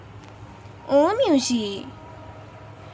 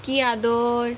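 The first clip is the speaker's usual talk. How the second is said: lower, very slow fond coo; tender aww; 5 semitones lower, much slower than usual. Real, not acted. Owner watches mother cat cuddle her kitten, tender and slow. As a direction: melt over the cuddle, drawn out slowly.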